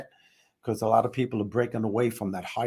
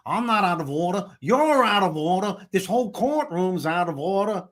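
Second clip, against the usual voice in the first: higher courtroom rant in a Pacino voice; 6 semitones higher than usual. Retold or imitated. Host quoting Pacino's courtroom rant, impersonated. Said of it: "he did that one movie, Al Pacino".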